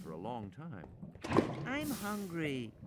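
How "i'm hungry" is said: put-on high, slow girl's complaint; whiny little girl. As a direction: drag it out like a hungry child.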